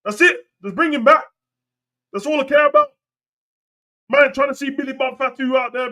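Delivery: very high-pitched mock pleading; hammed-up high-voiced plea; comic pleading rant. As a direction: push it up high, almost squeaky. High-voiced comic rant naming invented wrestlers.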